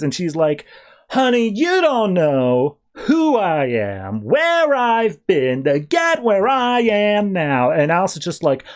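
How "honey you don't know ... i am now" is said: higher, very slow, drawn-out vamp voice; sultry Nocturna. Retold or imitated. Nocturna answering Alice's jab, high and very slow. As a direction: purr it slow and knowing, like a seductress.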